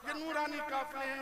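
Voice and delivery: usual speaking voice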